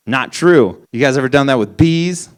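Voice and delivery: ordinary delivery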